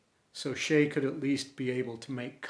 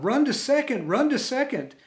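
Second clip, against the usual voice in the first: much higher, loud excited yell; 10 semitones higher, 4 dB louder than usual. Retold or imitated. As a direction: cheer him on to second at full voice. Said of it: "Everyone yelled".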